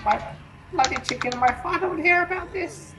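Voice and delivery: high sneering schoolboy voice, posh and whiny; Malfoy impression. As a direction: sneer it like a spoilt rich kid. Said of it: "That's like his catchphrase".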